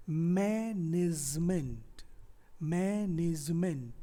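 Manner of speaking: management drawn out as maaa-nij-ment; slow pronunciation model. Shown as a demonstration. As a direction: stretch management slowly, syllable by syllable. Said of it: "we pronounce it".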